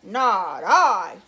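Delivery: low, loud gruff voice; gruff it out like a big dog. A narrator's reading. Dog refusing to help the hen, low gruff voice. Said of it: "said the dog".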